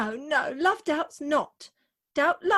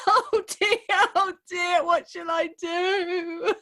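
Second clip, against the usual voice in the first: sobbing wail; 2 semitones higher, 3 dB louder, slower than usual. Acted. Bride sobbing that her wedding dress hasn't come, tearful wail. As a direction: wail it out between sobs.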